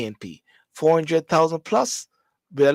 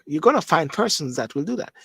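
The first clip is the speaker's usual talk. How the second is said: ordinary talk, nothing marked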